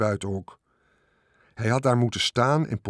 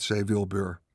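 plain reading voice

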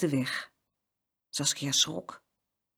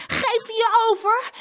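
high, loud, drawn-out challenge; small boy shouting with effort; straining demand; 6 dB louder, slower than usual. A narrator's reading. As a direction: demand surrender mid-wrestle. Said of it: "Jeroen hijgde van de inspanning".

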